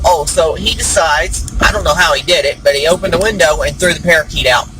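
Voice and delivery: ordinary talk, nothing marked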